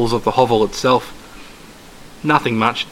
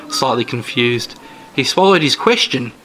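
ordinary delivery

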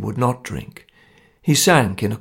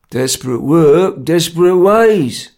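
slow, louder, unmoved reply; laconic; 5 semitones higher, 6 dB louder, slower than usual. A narrator's reading. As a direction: draw it out slowly, without sympathy. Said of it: "Jerry replied laconically".

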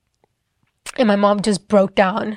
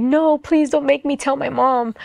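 higher-pitched, imitated tearful plea; retold tearful pleading; 5 semitones higher than usual. Daughter begging God not to make her tell, retold tearful pleading. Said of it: "And I would just cry".

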